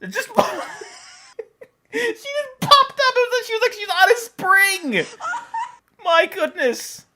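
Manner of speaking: high-pitched laughing gush. Real, not acted. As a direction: laugh while telling. Puppy pops up.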